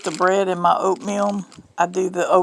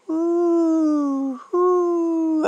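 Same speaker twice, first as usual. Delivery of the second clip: airplane noise; 8 semitones higher than usual. Pretend. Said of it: "I don't know what airplane sounds like that".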